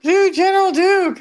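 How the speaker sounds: high, insistent mock request; imitating eager boys. Boys demanding another character from him, imitated eagerness. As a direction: call out a fan's request. Acts out boys begging for voices.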